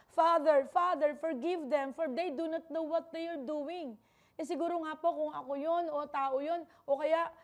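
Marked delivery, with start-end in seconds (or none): 0.1-3.9 higher, raised cry to the Father; acted pleading shout, pitched up; imitated shout of Jesus. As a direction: call out as if crying from the cross. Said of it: "sumisigaw siya".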